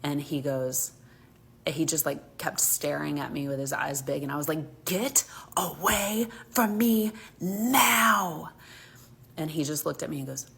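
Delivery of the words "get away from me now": slow, harsh whisper, higher pitch; menacing whisper. Retold or imitated. Mom warns the creepy man off in a slow, harsh whisper. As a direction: hiss a threat through your teeth. Threatening the stranger.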